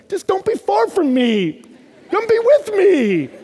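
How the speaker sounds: high, slow, loud begging cry; impassioned plea. Acted. Pleading with a professor as if with God, overwrought cry. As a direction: wail it out, stretching the final words.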